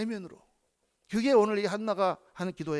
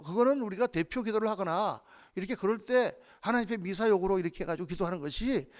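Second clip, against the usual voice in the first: ordinary talk, nothing marked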